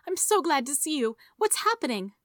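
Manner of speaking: high-pitched, eager female character; glad woman. A narrator's reading. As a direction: greet him eagerly in a high voice. Woman greets man, voiced by narrator.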